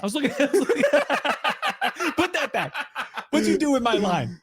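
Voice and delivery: high laughing chicken protest; mock chicken voice. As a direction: squeak it up as the annoyed bird. Voicing the offended chicken.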